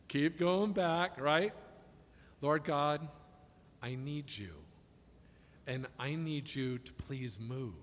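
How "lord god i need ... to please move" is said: much softer, slow and humble; humble prayer. Shown as a demonstration. Pastor models a humble prayer to God, much softer. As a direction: murmur it like a humbled plea.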